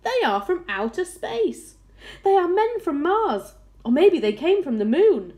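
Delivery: high, loud calls of wild speculation; excited crowd shouting. A narrator's reading. Crowd guessing where the strange ball came from, excited shouting. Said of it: "they shouted".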